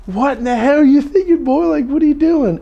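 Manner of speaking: high, loud barking state trooper; mock-angry cop demanding answers; angry trooper shout. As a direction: yell it like a furious cop who pulled you over. Trooper scolding, voiced again.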